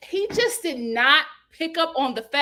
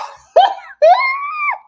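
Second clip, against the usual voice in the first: high, loud screaming laugh; shrieking laugh; 12 dB louder than usual. Real, not acted. Host losing it at the fight, shrieking laugh. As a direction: burst out cackling.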